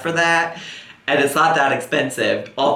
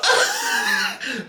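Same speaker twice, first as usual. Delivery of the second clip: very high burst of laughter; shrieking laugh; 4 dB quieter than usual. Real, not acted. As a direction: let out a shocked, squealing laugh. Reacting to mono story.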